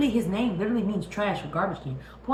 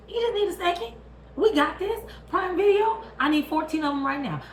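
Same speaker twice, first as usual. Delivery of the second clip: rally hard for the sequel; excited; 11 semitones higher than usual. Real, not acted. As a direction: fan hyping up He-Man's sequel, excited. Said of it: "excited rant".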